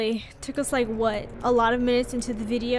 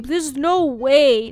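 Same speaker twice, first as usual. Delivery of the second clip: ordinary delivery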